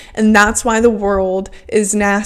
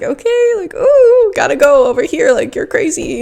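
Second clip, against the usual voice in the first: squeaky high-pitched "ooh" waving a hater away; high mock brush-off; 6 semitones higher than usual. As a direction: lightly brush it off in a high, amused voice. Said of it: "I was just like".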